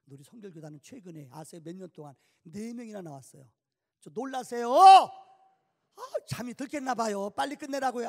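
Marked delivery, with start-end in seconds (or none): 4.0-8.1 louder, higher teasing complaint; mock-hurt at a dozing audience; playful exasperation. Real, not acted. Preacher teasing congregation for looking sleepy, louder.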